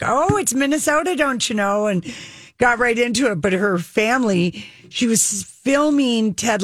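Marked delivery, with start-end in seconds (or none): 0.0-1.8 lightly imitated Minnesota accent, high on Oh; a bright, hint of Minnesota "don't you know"; playful Minnesota accent imitation. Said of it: "How did you get this accent?".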